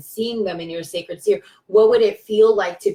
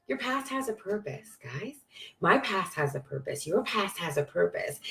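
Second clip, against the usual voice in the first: soft affirmation; 10 dB quieter than usual. Real, not acted.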